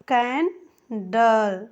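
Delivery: Candle drawn out very slowly, "ke-en-dal"; slow repeat. Shown as a demonstration. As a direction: stretch candle slowly so students hear each sound. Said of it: "ऐनक मैं आपको से बोलना चाहती हूँ". (छात्रों के लिए कैंडल बहुत धीरे)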